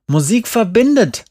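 "Musik verbindet" pitched very high, insistent; exasperated insistence. A narrator's reading. Jerry quotes the theme at the teacher, "Musik verbindet" high and exasperated. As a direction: hurl "Musik verbindet" as the winning argument.

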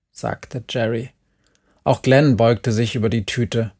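ordinary delivery

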